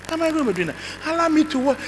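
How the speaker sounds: high, whining plea; pleading son. Retold or imitated. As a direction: beg like a lazy teenager. Son pleads to use machine.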